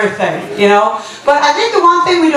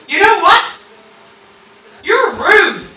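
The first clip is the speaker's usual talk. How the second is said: higher-pitched, emphatic mock confrontation; mock aggressive; 5 semitones higher than usual. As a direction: deliver a feeble insult as if it were fierce. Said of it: "gonna get a little bit aggressive".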